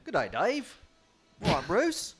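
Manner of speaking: high, loud hammed-up Australian accent; a hammed-up Aussie greeting, sounding half English; bad Australian accent. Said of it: "As an American trying to do an English accent, doing an Australian accent".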